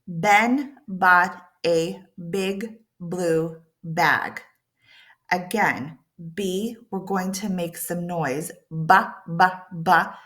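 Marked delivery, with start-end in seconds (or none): 0.1-4.4 slow. Shown as a demonstration. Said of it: "Notice how slow".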